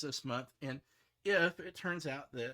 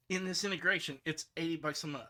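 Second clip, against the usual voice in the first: normal talking voice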